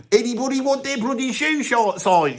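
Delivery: a lad touting for shoe shines; shoeshine boy call. Shown as a demonstration. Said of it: "I could say it better back then".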